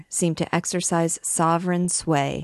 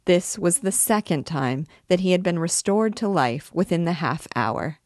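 ordinary delivery

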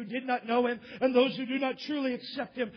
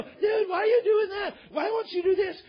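high-pitched, nagging questions; mock incredulity; 7 semitones higher than usual. People questioning a new believer, mock incredulity. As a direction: pester in exaggerated disbelief.

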